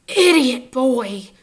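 slow, loud snarl. A narrator's reading. Teacher snarling at a clumsy boy, slow and loud. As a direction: spit the insult slowly. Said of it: "snarled Snape".

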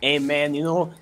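hammed-up Chicano street tough. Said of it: "I lost the accent a bit".

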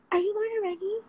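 giddy fan asking in disbelief; starstruck fan. Retold or imitated. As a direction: play the fan who just recognised her. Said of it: "is like".

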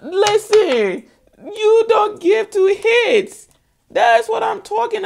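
high, loud, drawn-out exclamation; emphatic outburst. Real, not acted.

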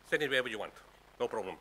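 usual speaking voice

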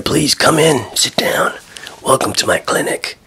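ordinary delivery

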